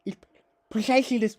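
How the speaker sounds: put-on high, loud lisped foreign accent; effortful philosopher caricature; Zizek. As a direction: push each word through a thick lisp. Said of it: "it's difficult".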